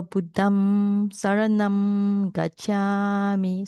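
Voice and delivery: Buddham Saranam Gacchami recited very slowly, leaning on the m and n; slow nasal recitation. Shown as a demonstration. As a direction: chant it slowly through the nose. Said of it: "that's the meaning of nasal".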